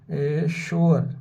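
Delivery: एश्योर said slowly, syllables drawn apart; slow down on एश्योर for the class; slow sounding out. Shown as a demonstration. Teacher to students, saying the word slowly. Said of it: "इसी तरह students अश्योर है".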